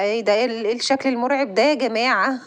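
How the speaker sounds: ordinary talk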